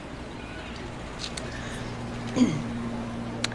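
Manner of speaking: quiet throat clearing to steady herself. Acted. Composes herself after the farewell.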